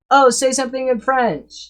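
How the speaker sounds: high-pitched, excited mock prompting; eager request. Curious friends ask a supposed French speaker to perform, eager request. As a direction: play an eager friend, voice jumping up.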